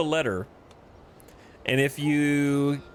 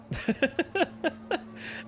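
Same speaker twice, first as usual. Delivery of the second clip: high breathy laugh; 14 semitones higher, 4 dB quieter than usual. Real, not acted. Laughs at the event text.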